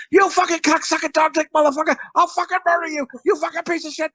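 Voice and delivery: high-pitched, acted raging mother; psycho mom tirade. Mom berating her kids while on the phone, acted fury. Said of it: "you being a fucking psycho".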